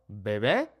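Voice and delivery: bebé with two soft b's; soft B sound. Shown as a demonstration. Teacher repeating the correct word. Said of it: "pronounced with the B sound".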